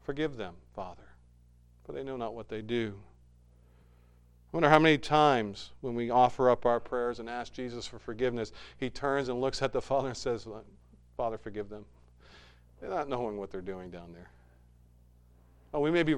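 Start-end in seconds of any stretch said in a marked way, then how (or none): 0.0-3.0 softer, a quiet plea to the Father; hushed prayer. Acted. Jesus on the cross praying for his killers, hushed. 11.2-14.3 softer, gentle voicing of Jesus pleading; soft intercession. Jesus asking the Father to forgive us, softly.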